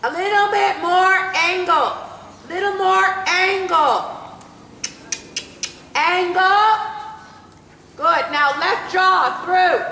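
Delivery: loud, drawn-out arena commands; brisk projected riding commands. Acted. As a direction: call it out across the arena.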